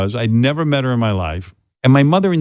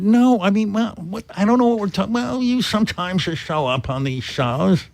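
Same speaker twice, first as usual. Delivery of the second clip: mother voice; 7 semitones higher, 4 dB quieter than usual. Retold or imitated. Son voicing his mother.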